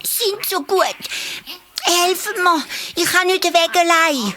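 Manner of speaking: high little hare voice pleading; squeaky small hare begging for help; hare calling for help. A narrator's reading. Little hare calls to the monkeys for help, high and pleading. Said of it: "ruft das Häschen".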